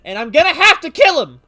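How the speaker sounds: high, loud jealous outburst; mock angry shout. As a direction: yell it in mock rage. Jealous joke about the doctor.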